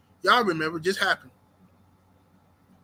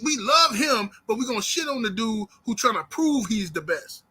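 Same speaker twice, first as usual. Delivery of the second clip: ordinary delivery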